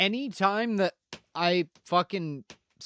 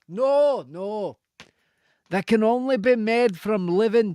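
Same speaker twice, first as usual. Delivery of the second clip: mock Scottish accent again. Answering questions about Blackthorn in a put-on Scottish accent. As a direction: back into the loose Scottish voice. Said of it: "we're going back to Scottish".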